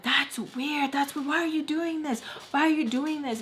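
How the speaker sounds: high-pitched imitated complaint; high, retold nagging questions; scolding. Acted scolding in retold quarrel.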